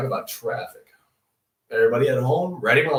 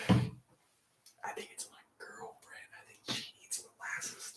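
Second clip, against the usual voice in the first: softer, slow whisper; 16 dB quieter, slower than usual. Real, not acted. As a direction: lean in and whisper the secret. Confiding about girlfriend, whispering.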